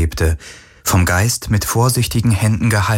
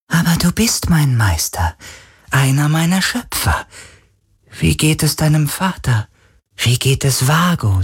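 eager spirit voice; 8 semitones higher than usual. Acted. Spirit asks after creator.

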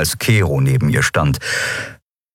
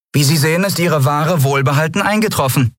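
höhere Stimme, betont geschäftsmäßig; höher und lauter, die Lieferung vorzeigen; Kapitänin Andriel, forsch; 9 semitones higher than usual. A narrator's reading. (Kapitänin präsentiert dem Kunden die Ware, höhere Stimme)

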